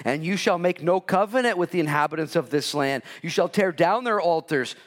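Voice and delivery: the Lord commanding Israel. Acted. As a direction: proclaim it like a decree. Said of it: "he also gave them instruction".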